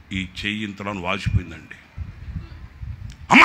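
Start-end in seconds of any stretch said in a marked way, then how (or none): 3.3-3.4 sudden loud ouch cry; cry of pain. Retold or imitated. Hand struck by the handle, sharp pain cry. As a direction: yelp as the hand gets struck.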